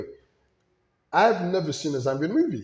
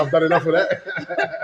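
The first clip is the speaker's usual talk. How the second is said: laughing through the words, a bit louder; 4 semitones higher, 6 dB louder, slower than usual. Real, not acted. Answering the host about having kids, laughing. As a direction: chuckle it out, slowly.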